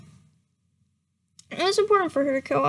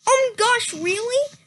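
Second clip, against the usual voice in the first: excited; 7 semitones higher than usual. Acted. Voicing Starpaw in the story.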